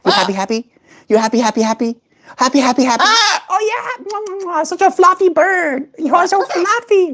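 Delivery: very high, sing-song cooing with kisses. Real, not acted. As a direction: dote on the fluffy bird. Cooing at bird.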